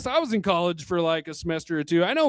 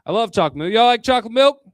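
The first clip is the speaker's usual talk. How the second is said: ordinary talk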